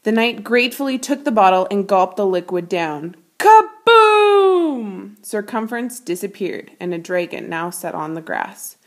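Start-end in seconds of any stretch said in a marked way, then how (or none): 3.4-5.2 high, loud explosive shout; kaboom. A narrator's reading. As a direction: blast the word out like a bang. Explosion in the story.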